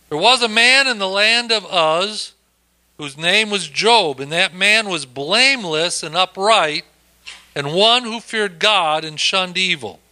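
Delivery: scripture reading. Acted. Reads the scripture aloud.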